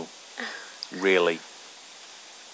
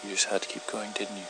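quiet menace; 3 semitones higher, 8 dB quieter, faster than usual. Acted. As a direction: let the threat simmer quietly. Low menacing after long pause.

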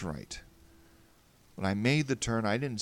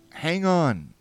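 raised, louder call to someone ahead; 8 semitones higher, 11 dB louder than usual. A narrator's reading. Rushing toward a stranger's voice, calling out. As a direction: call it out high and loud. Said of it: "and called out".

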